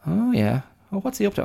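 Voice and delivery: high, nosy pig voice; high squeaky pig chatter; curious pig. Retold or imitated. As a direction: gossip like a pig in the mud. Pig dialogue continues.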